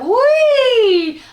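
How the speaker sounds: high, loud squeal of delight; gleeful whee. Acted. The plate flying as a frisbee, a high gleeful whee. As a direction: let the plate squeal as it flies.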